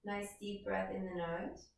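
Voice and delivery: slow, measured yoga-class cueing; guided breathing. Acted. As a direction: draw the words out to pace the breath. Leads a breathing exercise.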